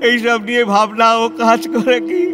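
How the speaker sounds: high, broken sobbing with halting questions; weeping. Acted. Lonely reformer asks if he is heartless, sobbing. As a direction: break down, crying through the words.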